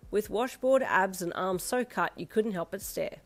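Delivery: usual speaking voice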